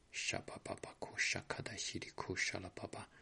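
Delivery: breathy whispered glossolalia; a pastor whispering in tongues during prayer. Acted.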